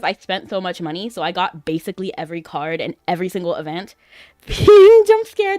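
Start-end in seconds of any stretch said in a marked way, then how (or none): 4.5-5.6 laughing yelp at a sudden ping, higher and louder; startled laugh. Real, not acted. Startled by the ping on stream, laughing yelp. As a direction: jump at the noise and laugh it out, slow.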